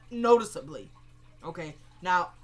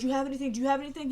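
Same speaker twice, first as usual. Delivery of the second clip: higher mock pleading questions; 4 semitones higher, much faster than usual. Man teasing his captive who wants a fix, mocking. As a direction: repeat it teasingly, imitating someone begging. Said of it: "the guy's just mocking him".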